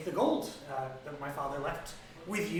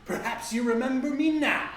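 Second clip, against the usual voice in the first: higher, louder booming dragon voice; gold dragon; 9 semitones higher, 7 dB louder than usual. Acted. Dragon revealing himself to Rainier, booming. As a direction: loom over him and boom it out. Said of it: "now an adult gold dragon".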